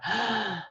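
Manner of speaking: long, soft audible breath; deep breath. Shown as a demonstration. Coach demonstrating how to pause when triggered, deep breath. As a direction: show a deep calming breath. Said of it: "take a deep breath".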